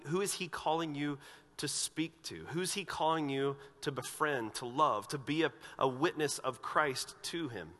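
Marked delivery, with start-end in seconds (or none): none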